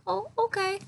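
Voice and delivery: high-pitched acted agreement; mock compliant woman.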